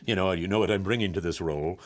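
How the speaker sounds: low, self-important actor; low, softened pomp, all composure; straight-faced thespian. Acted. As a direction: play it straight as a lofty stage actor. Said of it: "you're keeping that very straight".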